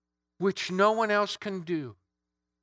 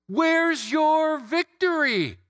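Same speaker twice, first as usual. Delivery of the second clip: much higher and louder, mocking taunt flung at death; taunting trash talk; 10 semitones higher, 6 dB louder, slower than usual. Paul taunting death, loud defiant trash talk. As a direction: throw it in death's face like a boast. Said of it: "I think of this as trash talk".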